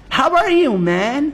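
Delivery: a casual king testing him in English; Pharaoh in English. Acted. Pharaoh greeting Joseph in English, lightly voiced. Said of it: "פרעה שואל אותו באנגלית".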